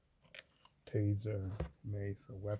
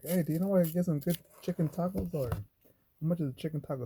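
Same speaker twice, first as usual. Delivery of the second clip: bright, higher, louder customer voice; eager customer; 7 semitones higher, 6 dB louder, faster than usual. Retold or imitated. Customer asking the barista about tacos, eager and high. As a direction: play the clueless guy asking loudly about tacos.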